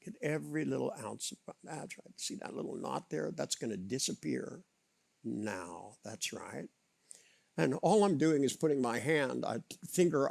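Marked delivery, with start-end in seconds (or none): 0.0-6.7 soft spa whisper. Acted. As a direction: soothe the tension away. Hypnotic relaxation on knot.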